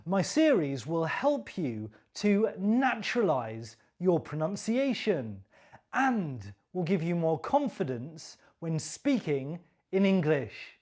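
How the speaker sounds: ordinary talk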